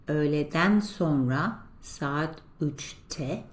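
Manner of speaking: each Turkish word slow and clear; slow model pronunciation. Shown as a demonstration. Teacher showing students the afternoon phrase, slow. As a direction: lay out öğleden sonra saat üçte slowly. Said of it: "we say".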